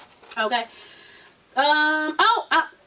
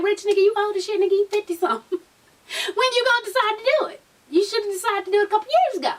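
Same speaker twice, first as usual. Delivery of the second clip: incredulous scolding; 7 semitones higher, faster than usual. Acted. Ribbing Rich over his age.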